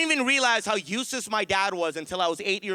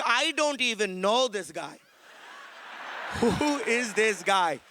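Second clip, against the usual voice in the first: dad disowning him; slower than usual. Retold or imitated. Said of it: "He's like".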